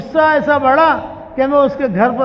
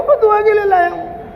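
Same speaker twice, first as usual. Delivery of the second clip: scolding; 6 semitones higher than usual. Retold or imitated. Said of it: "غصہ آیا، بہت ڈانٹا اس کو".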